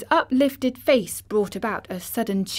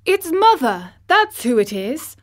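much higher and louder joyful cry; excited cry; 6 semitones higher, 8 dB louder than usual. Acted. Dickon crying out on seeing his mother arrive, overjoyed. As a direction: burst out with joy at seeing her. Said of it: "he cried".

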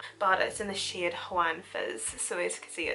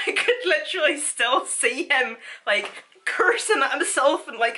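laughing; 7 semitones higher, 6 dB louder than usual. Real, not acted. Laughing while retelling.

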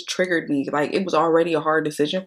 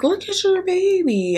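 much higher, slow sing-song imitation; mock cooing nurse. Mimicking the tech urging her to look, mock sweet. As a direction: gush it like an overly sweet nurse. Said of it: "she's like".

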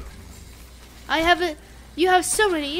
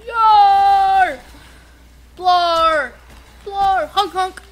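loud goofy honking cries; silly clown; 8 dB louder, much slower than usual. Pretend. Goofy noises while playing.

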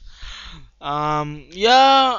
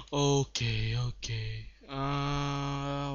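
usual speaking voice

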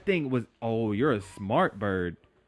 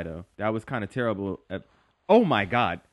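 ordinary delivery

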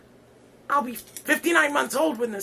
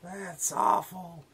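slow, low, mock-appalled; disgusted; 7 semitones lower, 7 dB quieter, much slower than usual. Host appalled by the guest's cheap stunt. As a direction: let the disgust sink in slowly.

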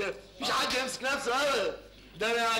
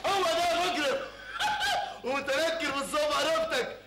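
laughing triumph; 7 semitones higher, slower than usual. Acted. Gleeful unmasking of the visitor.

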